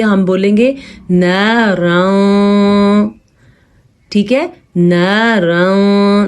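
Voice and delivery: ناراں drawn out with hidden nasal sound, no resonance; ikhfa demo. Shown as a demonstration. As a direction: stretch ناراں slowly with a muted nasal. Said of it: "چھپانے والی کیفیت".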